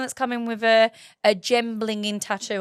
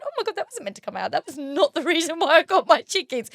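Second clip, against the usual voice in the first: flustered retold panic; 7 semitones higher than usual. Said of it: "And I went".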